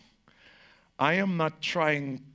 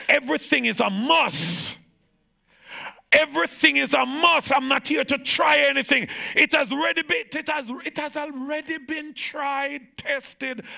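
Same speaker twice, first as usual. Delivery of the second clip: high, loud preacher's shout; full-force preaching; 10 semitones higher, 4 dB louder than usual. Acted. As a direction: preach it out with fire. Preaching climax.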